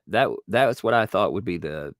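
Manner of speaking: usual speaking voice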